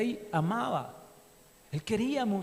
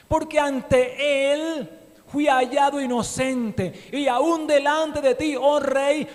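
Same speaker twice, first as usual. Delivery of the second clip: loud, high joyful cry; effusive glory voice; 6 semitones higher, 8 dB louder, slower than usual. A narrator's reading. Daniel answering the king from the den, loud and joyful. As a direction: exult as you greet the king. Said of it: "una voz de gloria, de exaltación a Dios".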